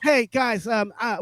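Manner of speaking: hesitant, sarcastic address to a crowd; mock stage announcement.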